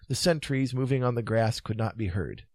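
ordinary delivery, nothing marked

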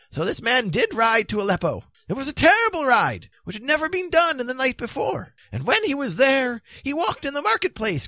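high-pitched, animated wizard storyteller; high, theatrical Merlin voice; Merlin telling a grim tale. Acted. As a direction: spin the tale high and emphatically, as old Merlin. Merlin tells a tale in character.

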